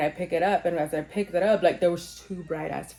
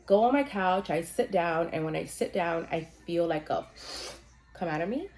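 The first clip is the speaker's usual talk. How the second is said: ordinary talk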